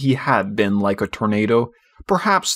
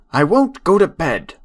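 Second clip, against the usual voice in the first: put-on a defiant little boy's shout, held back; mild child's shout; 6 semitones higher, 4 dB louder than usual. A narrator's reading. Michael refuses his nurse at bedtime, mild childish shout. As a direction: stamp your foot like a small boy. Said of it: "he had shouted".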